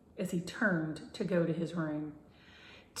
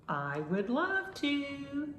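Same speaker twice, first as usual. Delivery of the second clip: ghostly yip; 9 semitones higher, slower than usual. A narrator's reading. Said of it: "with a ghostly yip".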